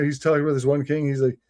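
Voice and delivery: ordinary delivery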